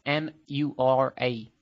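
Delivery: slow, letter-by-letter spelling; dictating. Real, not acted. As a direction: spell each letter out clearly. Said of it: "I'll spell it out for you".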